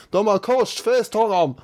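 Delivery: high-pitched mock bellow at normal volume; mock crowd yell. Folk outside the courtroom protesting Kelly's conviction, mock yell. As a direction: cry it out high, imitating an outraged crowd. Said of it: "står och vrålar".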